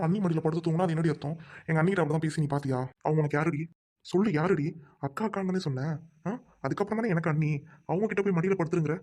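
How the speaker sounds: ordinary talk, nothing marked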